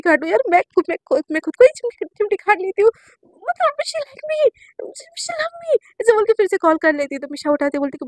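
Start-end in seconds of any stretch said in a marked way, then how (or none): 3.3-5.8 very high, slow, into a whisper; breathless thrill. Retold or imitated. Girl whispering to herself that her crush loves her. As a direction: whisper the joy to yourself.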